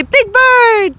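high prompt. Real, not acted. Prompting goose trick.

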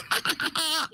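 low, soft gloating laugh; thieving chuckle. Acted. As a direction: gloat quietly over the loot. Ruba tutti i diamanti.

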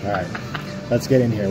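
ordinary talk

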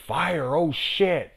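high, slow, drawn-out fright; hammed-up alarm; mock panic. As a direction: cry out like someone spotting flames. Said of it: "people will be like".

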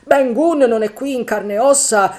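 frightened sailor arguing it changes nothing; worried Dick. Acted. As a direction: press the point anxiously. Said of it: "intervenne Dick".

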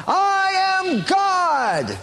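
high, drawn-out mock proclamation; hammed-up self-deifying cry, every word stretched; Shirley MacLaine. Woman on the seashore convincing herself, mock proclamation. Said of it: "You've gotta just shout it out".

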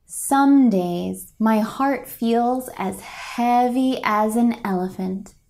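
ordinary delivery

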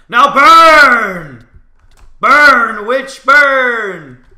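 high, very loud drawn-out yell; hammed-up scream, stretching each BURN; witch-burning cry. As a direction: bellow it like a mad witch hunter. Playful witch-hunter cry setting opponent alight.

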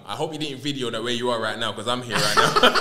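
cracking up while talking; laughing. Real, not acted. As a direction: laugh through the joke. Laughing at cameraman joke.

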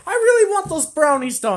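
high, loud wishful plea; longing whine. Acted. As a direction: wail how badly you want it. Pleads desire for forbidden brownies.

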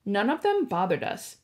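ordinary delivery, nothing marked

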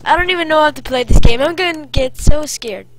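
babbling fast as fear kicks in; panicky. Real, not acted. Streamer spooked by the game, rushing nervously.

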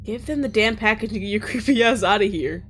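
loud, forceful dismissal rising to a bark; booming. Acted. Jacob chasing off the mailman, booming voice. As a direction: run him off with a boom. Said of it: "Jacob's voice boomed".

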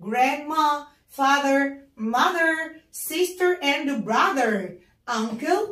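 very slow, careful sister and brother; teacher reviewing family words slowly; slow model words. Shown as a demonstration. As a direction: draw each word out for the class. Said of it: "بنراجع عليها تاني".

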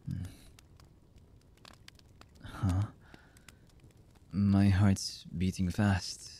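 slow, halting lover's voice with long pauses; hesitant, emotional confession. Acted. Lover confessing horrible deeds and love to the beloved, halting and emotional. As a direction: confess haltingly, one fragment at a time. Voice actor's romantic audio roleplay.

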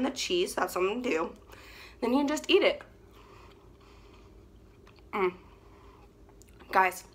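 talking with mouth partly full; mumbled through chewing; while chewing food. Real, not acted. Seen 0: Bites fry at -2, closed-mouth chewing until about 2.3 s.

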